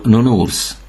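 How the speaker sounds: nounours with each ou as "oo"; French nounours, "noo-noors". Shown as a demonstration. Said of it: "O U betűcsoportot U-nak ejtjük".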